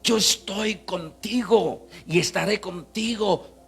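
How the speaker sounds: ringing pulpit declaration, stretched at the climax; full-force preaching. Acted. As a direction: proclaim the promise with growing force. Preaches God's promise at full force.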